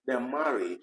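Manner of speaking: normal talking voice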